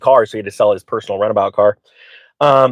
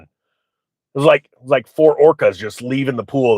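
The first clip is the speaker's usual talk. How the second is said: ordinary talk, nothing marked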